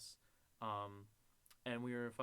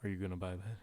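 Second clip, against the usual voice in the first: low put-on man's voice; creepy clerk imitation; 6 semitones lower than usual. Clerk leaning into his ear, low insinuating imitation. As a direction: drop down low as the looming clerk. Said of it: "leaning in my ear and going".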